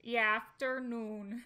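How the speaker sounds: "E afternoon." slow, flat Minnesota vowels; Minnesota accent. Shown as a demonstration. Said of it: "like, E after".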